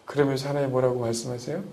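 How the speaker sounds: ordinary delivery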